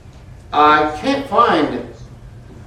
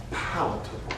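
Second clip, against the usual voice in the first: ordinary talk, nothing marked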